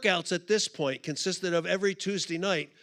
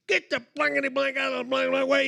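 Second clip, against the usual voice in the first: high, fast censored tirade; hammed-up scolding, pitched high and rushed; mock ripping rant; 7 semitones higher, faster than usual. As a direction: imitate an old lady cussing you out. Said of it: "She's ripping me".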